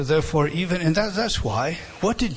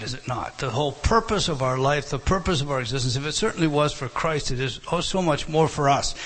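usual speaking voice

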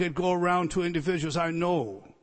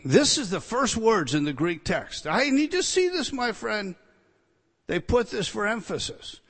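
ordinary talk

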